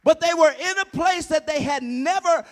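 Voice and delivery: loud, rising sermon; preaching at full force. Acted. Preacher rouses congregation, shouting with rising fervor. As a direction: drive it home to the congregation.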